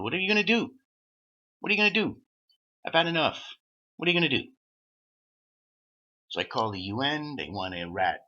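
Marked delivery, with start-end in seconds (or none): 0.0-4.5 loud, high defiant challenge; defiant shout. Real, not acted. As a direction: throw it at them, fed up. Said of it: "I don't give a fuck anymore".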